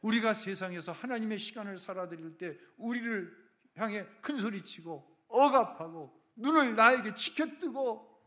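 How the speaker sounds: ordinary talk, nothing marked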